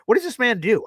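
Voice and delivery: ordinary talk, nothing marked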